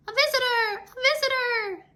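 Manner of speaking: high, loud squeaky cheer; excited cassava. A narrator's reading. Cassava crowd squealing at the visitor, high and excited. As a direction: greet the guest with high delight. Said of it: "they said".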